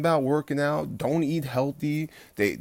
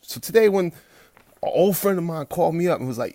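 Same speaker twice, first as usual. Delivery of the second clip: ordinary delivery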